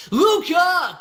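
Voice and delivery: loud, high shout of a name; big excited shout-out; calling out. Real, not acted. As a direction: holler the name like a hype man. Greets a donor by name.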